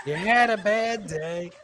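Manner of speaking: normal talking voice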